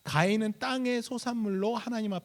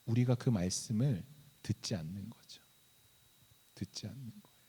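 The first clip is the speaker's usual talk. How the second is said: hushed; 7 semitones lower, 7 dB quieter than usual. Real, not acted. Sermon climax, hushed.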